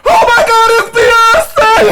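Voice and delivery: very high, loud starstruck scream; hammed-up shriek of a thrilled fan; King Shark. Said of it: "King Shark goes".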